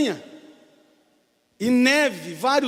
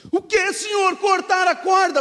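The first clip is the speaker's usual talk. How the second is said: higher, louder incredulous question; shocked disbelief; 9 semitones higher, 7 dB louder than usual. Acted. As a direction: recoil at the order. Climber in disbelief.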